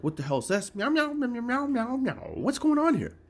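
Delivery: very high, squeaky cat voice with meows; voicing the cat. Startled cat reacting to the scanner, very high squeaky meows. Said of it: "She's looking at it like".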